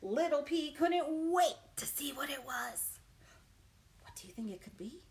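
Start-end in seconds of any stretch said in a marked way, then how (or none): 4.0-5.0 whisper. Real, not acted. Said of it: "whispered question to kids".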